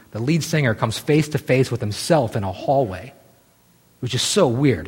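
ordinary talk, nothing marked

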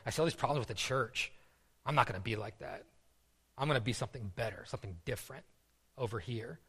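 a hint of a smug believer, half under the breath; smug mock quote. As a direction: rattle it off quietly like someone sure they're better. Voicing a smug churchgoer.

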